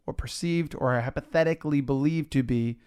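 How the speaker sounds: ordinary delivery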